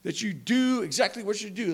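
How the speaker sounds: ordinary delivery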